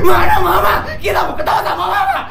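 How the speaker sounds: high, loud bratty call for mom; whiny shout for mama. Acted. Spoiled child yelling for mama past the maid, whiny shout.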